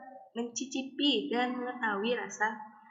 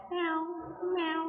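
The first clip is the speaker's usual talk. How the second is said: high imitated cat meow; imitasi meong kucing; 7 semitones higher than usual. Shown as a demonstration. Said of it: "suara kucing". (suara kucing mengeong)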